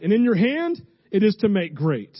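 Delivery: preached reading. Acted. First forceful scripture reading.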